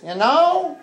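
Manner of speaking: put-on very high-pitched old-lady caricature; old grandmothers. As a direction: mimic American grannies way up in falsetto. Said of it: "Alta Babas mean old grandmothers".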